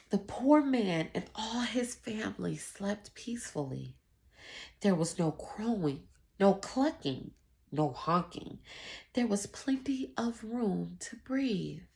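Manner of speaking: usual speaking voice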